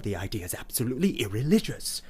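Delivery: quick scandalised whisper; hurried hushed outrage; fast whisper. Acted. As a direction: rush it out under your breath.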